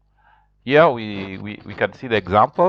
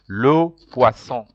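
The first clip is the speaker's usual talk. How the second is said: Le poisson stretched slowly, luh pwa-son; slow it down so they can copy; slow model word; slower than usual. Shown as a demonstration. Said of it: "Repeat after me".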